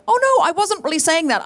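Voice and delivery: high, flustered retold apology; apologizing. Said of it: "I started apologizing and going".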